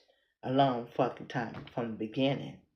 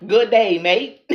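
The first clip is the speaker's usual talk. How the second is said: loud, higher, hammed-up Australian greeting; Aussie accent attempt; 6 semitones higher, 15 dB louder, slower than usual. Shown as a demonstration. Showing viewers the stretched-lip trick, hammed-up Aussie accent. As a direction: stretch your lips wide for a big Aussie twang. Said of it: "Just stretch, stretch your lips out".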